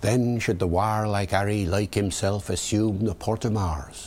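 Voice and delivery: original pronunciation, dropped H in Harry; Early Modern English verse accent; Shakespearean original pronunciation. Shown as a demonstration. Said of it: "David the O P".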